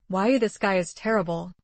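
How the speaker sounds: usual speaking voice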